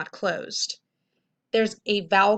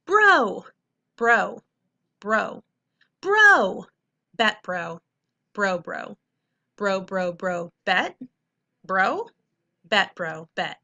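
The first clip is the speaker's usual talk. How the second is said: a hammed-up, playful read of bro and bet; expressive reading; 4 dB louder, slower than usual. Shown as a demonstration. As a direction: read each bro with exaggerated expression to show punctuation. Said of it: "use the same amount of expression".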